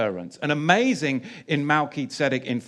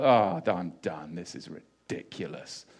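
exasperated self-quote; 10 semitones lower, 7 dB quieter than usual. Retold or imitated. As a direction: act out giving up in disgust, trailing to near-whisper. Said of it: "this is what made me go".

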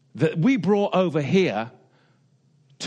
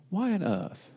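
whispered question; 6 semitones higher, 4 dB quieter than usual. Real, not acted. Dramatic rhetorical question opening.

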